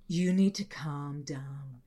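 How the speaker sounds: low, slow condescending calm; calm boyfriend. Retold or imitated. Boyfriend talking down to girlfriend, low slow patronising. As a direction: soothe her low and slow.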